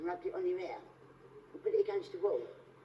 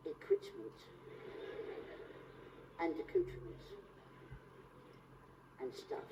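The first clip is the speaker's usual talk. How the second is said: fading whisper; 6 semitones higher, 10 dB quieter than usual. Acted. List fading out into near whisper.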